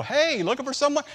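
calling out. Retold or imitated. Calls out from car window.

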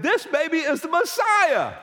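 high, slow, awed exclamation; excited old voice. Retold or imitated. Old couple announcing to Joseph and Mary, awed and high. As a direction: proclaim it in wonder.

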